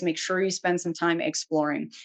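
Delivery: normal talking voice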